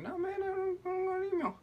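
very high squeaky falsetto; hammed-up falsetto protest; mock protest. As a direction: squeak out a silly denial. Acted reply to the angry customer.

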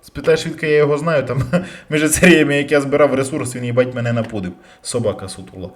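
normal talking voice

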